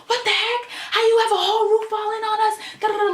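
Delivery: high-pitched indignant tirade at the staff; going off. Retold or imitated. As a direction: rant at them, pitch flying up. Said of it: "I'm going off like".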